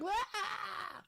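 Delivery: hammed-up high squeal; mock scream. Mocking the commentary audience, high fake squeal. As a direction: squeal like startled listeners. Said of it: "the people are like".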